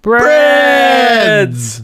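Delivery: high, loud shout; shouted word. Acted. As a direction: belt the word out. Joke shouting plural words.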